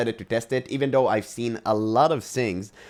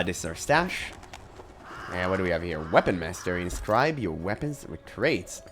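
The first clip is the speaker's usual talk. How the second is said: ordinary delivery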